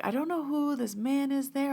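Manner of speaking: high, wondering retold voice; puzzled medium. Bree wondering who she senses, high puzzled voice.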